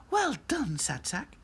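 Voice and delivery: high rag-doll voice dropping away; pleased Lucy. A narrator's reading. Lucy congratulating Sad Sack, pleased. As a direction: praise in a high little voice.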